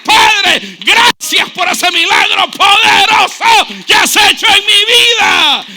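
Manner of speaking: loud, high, slow cry of thanks; jubilant shout. Acted. Believer thanking the Father for the miracle, loud and high. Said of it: "usted va a decir".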